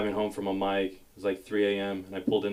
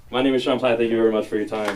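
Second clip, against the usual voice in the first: sign-off; 7 dB louder, faster than usual. Real, not acted. Comic signing off set.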